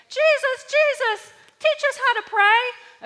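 high, loud, pleading cry; pleading disciples. Retold or imitated. Disciples running up, begging Jesus to teach them. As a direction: beg Jesus like eager disciples. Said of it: "his disciples come running up one day".

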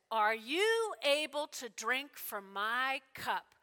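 slow, weighty question with drawn-out words; grave question. Acted. Jesus questioning James and John, slow and grave. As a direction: ask it deliberately, letting each word land. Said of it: "And then he asks the question".

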